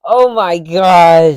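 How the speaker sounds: hammed-up slow gasp of delight; swooning. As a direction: melt over it slowly. Marvelling at the jellyfish.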